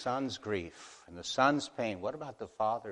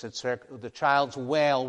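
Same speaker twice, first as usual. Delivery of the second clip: ordinary delivery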